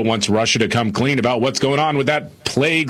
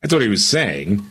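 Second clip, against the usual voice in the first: normal talking voice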